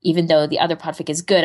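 ordinary delivery

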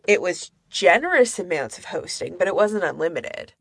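normal talking voice

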